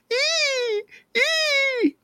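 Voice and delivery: very high, squealing shrieks; baby bird scream. A narrator's reading. Baby bird dragged by frog's tongue, very high screams. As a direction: squeal like a tiny terrified bird. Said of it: "The baby bird screamed".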